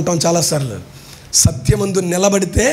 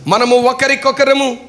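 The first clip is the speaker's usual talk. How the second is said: slow, high reading of the verse; scripture reading; 7 semitones higher, slower than usual. Acted. Preacher reading Ephesians to the congregation, high slow reading voice. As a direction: lift the verse and read it slowly.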